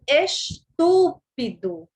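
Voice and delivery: "estúpido" slowly, TÚ set apart and held; stressed syllable lengthened. Shown as a demonstration. Teacher showing weak syllables around the tonic, "estúpido" with TÚ drawn out. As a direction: stretch TÚ in "estúpido", the other syllables short. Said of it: "são as sílabas átonas".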